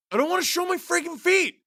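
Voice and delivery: very high, exasperated squeal; whiny protest. Real, not acted. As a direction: refuse in a high-pitched whine. Protests louder a viewer's request.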